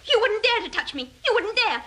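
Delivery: high, panicky defiance; frightened defiance. Acted. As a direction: bluff bravely through rising panic. Threatened with a knife.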